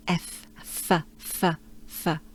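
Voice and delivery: "F" sussurrado e prolongado; long f. Shown as a demonstration. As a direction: sopre o "f" entre dentes e lábio. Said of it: "start it with an F".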